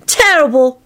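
put-on high, sulking child voice; sulky. A narrator's reading. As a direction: answer in a boyish sulk. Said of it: "Teddy sulked".

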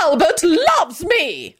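high, slow wail; drawn-out, high-pitched protest; strident howl. A narrator's reading. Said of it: "Caroline howled stridently".

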